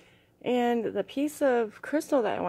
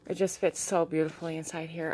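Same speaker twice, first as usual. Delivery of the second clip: ordinary talk, nothing marked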